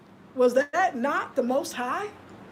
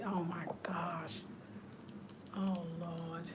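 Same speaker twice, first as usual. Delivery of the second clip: slow, much softer whisper; 6 semitones lower, 14 dB quieter, slower than usual. Real, not acted. Vlogger overwhelmed by the gift, whispering. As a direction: breathe it out in awe.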